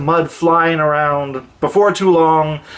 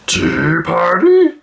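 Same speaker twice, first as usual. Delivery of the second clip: shy troll voice; 3 semitones higher, slower than usual. Acted. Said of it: "he heard a deep voice say".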